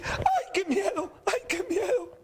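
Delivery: very high, drawn-out mimicking whine; mocking. Preacher voices God making fun of the frightened person, mocking. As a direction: taunt by parroting someone's frightened wail. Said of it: "Dios va a burlarse de usted".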